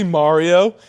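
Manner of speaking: "Mario" said MAH-rio, with a broad first vowel; others' MARIO. Shown as a demonstration. Podcaster quoting how people say the name, "Mario" as MAH-rio. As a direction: stretch "Mario" as MAH-ree-oh.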